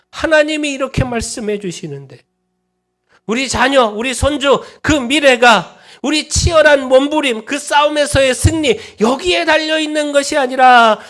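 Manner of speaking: high, loud preaching crescendo; full-force preaching. Acted. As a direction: preach it out to the whole hall.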